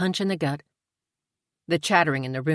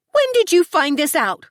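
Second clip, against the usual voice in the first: much higher, louder demand; 13 semitones higher, 7 dB louder than usual. A narrator's reading. Tori demanding answers from Amy, high and loud. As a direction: Tori fires the question hard. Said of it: "Tori demanded".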